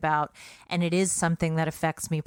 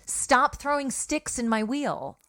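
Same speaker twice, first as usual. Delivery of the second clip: higher-pitched, pointed telling-off; pointed rebuke; 6 semitones higher than usual. Real, not acted. Podcaster scolds co-host for interrupting her flow, pointed rebuke. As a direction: turn the saying on your listener, pitched up.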